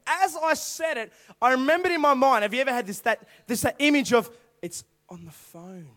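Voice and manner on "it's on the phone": very soft, low, slow reveal; hushed revelation. Acted. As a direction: voice the picture appearing in your mind. Acted sudden mental image of wallet.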